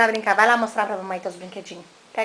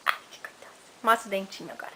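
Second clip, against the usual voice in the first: whispering; 3 semitones lower, 4 dB quieter than usual. Real, not acted. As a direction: whisper it tenderly to a little one. Mother whispering to baby.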